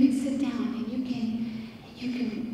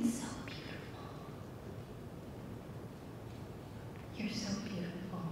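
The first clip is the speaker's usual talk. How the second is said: tender whisper; 6 semitones lower, 21 dB quieter, faster than usual. Acted. Father whispering love over her heart, hushed. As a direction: whisper it softly, like a secret.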